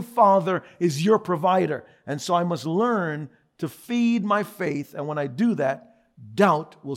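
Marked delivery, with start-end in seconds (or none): none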